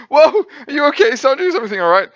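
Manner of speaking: high panicky check on a friend; worried friends. Retold or imitated. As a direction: call out in sudden concern. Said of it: "being like".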